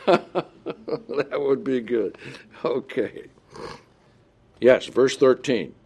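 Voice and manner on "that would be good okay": slow words through a chuckle; laughing. Real, not acted. Preacher enjoying his own Texan joke, laughing. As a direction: talk as the laughter dies down.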